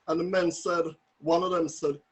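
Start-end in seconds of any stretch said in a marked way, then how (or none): none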